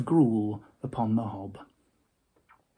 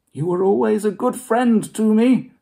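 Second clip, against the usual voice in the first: placating Scrooge; 9 semitones higher, 6 dB louder than usual. Acted.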